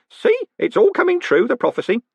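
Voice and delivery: put-on very high, vindicated old postman; triumphant. A narrator's reading. Groat proclaiming the prophecy, triumphant. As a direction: crow it in a high voice. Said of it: "said Groat triumphantly".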